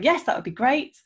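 high, keen retold yes; eager acceptance. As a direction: re-enact an over-willing, bright agreement. Retold eager acceptance despite no idea.

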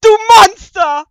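mock-outraged yell; disgusted shout. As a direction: shout it out, appalled. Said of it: "ruft Luna angewidert".

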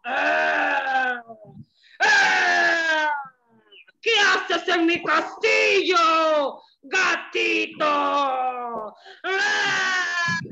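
loud slow ogre roar. Acted. The ogre finds the cat in his castle, loud roaring. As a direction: boom it out like an angry ogre. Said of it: "Y el ogro dijo".

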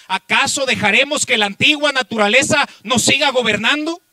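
exhorting preacher, building in force; impassioned preaching. Real, not acted. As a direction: press the congregation with urgent rhetorical questions.

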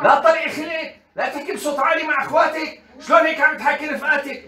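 high, loud scolding mother; hammed-up telling-off at full voice. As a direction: mimic a mother yelling at her child. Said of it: "صوتك هيك وعم تقولي له لا تعلي صوتك".

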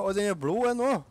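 high, loud alarmed call; excited. Real, not acted. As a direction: call it out in a panic. Alarmed during a fight.

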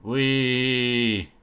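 long drawn-out gleeful whee; playful whee. Pretend. Player trying wall climbing, long playful whee. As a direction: let out a sustained ride squeal.

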